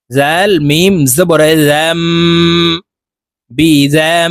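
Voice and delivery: "জাম" closed on a long nasal m; slowly spell, humming out the end of "জাম"; iqlab ghunnah. Shown as a demonstration. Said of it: "ছোট্ট মিমের সাথে গুন্না করে পড়ব". ("জাম"-এর শেষে মিমে লম্বা গুন্নাহ)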